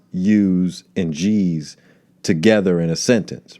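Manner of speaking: ordinary talk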